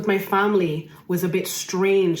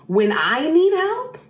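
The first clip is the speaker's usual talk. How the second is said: "need" drawn out high and slow, rising at the end; slow, high, aching "When I need help"; pleading lament on "need"; high plaintive rise; 9 semitones higher, 5 dB louder than usual. Retold or imitated. Repeated, first take rising high.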